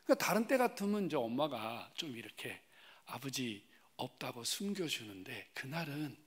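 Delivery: normal talking voice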